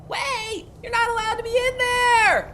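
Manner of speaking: put-on very high, loud girly shriek of protest; girly high-pitched kobold. Tiny kobold caretaker yelling at intruders at the door, girly high-pitched shout. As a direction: yell in a tiny girly squeal. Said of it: "kind of girly, high-pitched voice".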